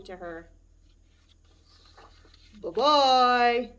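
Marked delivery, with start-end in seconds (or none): 2.6-3.7 loud, gruff Papa Bear goodbye; big gruff bear voice. Acted. Papa Bear calls goodbye to the girl, loud and gruff. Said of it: "a big, gruff voice".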